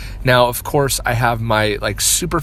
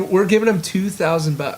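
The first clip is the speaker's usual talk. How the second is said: excited; 7 semitones higher than usual. Real, not acted. Co-host marvels at free course.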